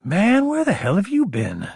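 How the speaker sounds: high-pitched, indignant friend; exasperated demand. A narrator's reading. Annoyed friend confronts returning Logan.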